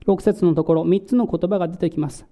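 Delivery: ordinary talk, nothing marked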